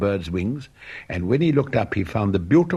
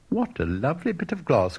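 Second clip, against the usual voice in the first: put-on little girl's cry; 7 semitones higher than usual. A narrator's reading. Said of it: "cried the little girl".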